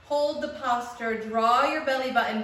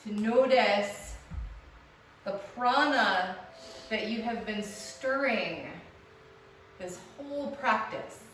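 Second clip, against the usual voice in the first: guided meditation. Acted. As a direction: ease them into stillness. Guided relaxation lying down.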